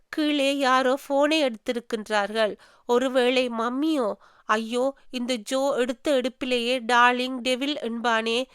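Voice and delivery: plain reading voice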